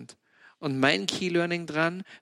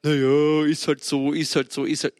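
shrugging resignation; 4 dB louder than usual. Retold or imitated. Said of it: "kannst du sagen".

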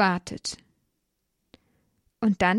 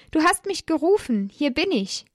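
put-on raised, eager boy's voice; a child answering his master keenly; young Samuel; 7 semitones higher than usual. A narrator's reading. Boy Samuel runs to Eli at night, higher eager child voice.

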